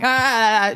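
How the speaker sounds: brief pretend wail; mock crying wail. Storyteller acting out her tears after a crash, mock wail. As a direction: imitate bursting into tears. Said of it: "Immediately crying".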